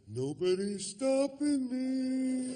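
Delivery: high, slow swaggering boast; cocky. Retold or imitated. Comic boarding the yacht, cocky and slow. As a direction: strut up the plank like you own it. Strutting onto yacht gangplank.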